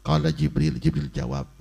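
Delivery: usual speaking voice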